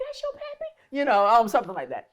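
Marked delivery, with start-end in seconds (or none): 0.0-0.7 squeaky high mock question; high disbelief. Hearing whose daughter the host is, squeaky high. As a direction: squeal it out, amazed. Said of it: "I'm like".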